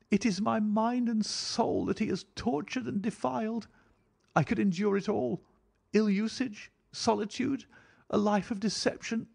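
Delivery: high, impassioned woman near sobbing; passionate, anguished wife. A narrator's reading. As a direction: pour out the betrayal in a high female voice. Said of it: "broke into a passionate sobbing as she spoke".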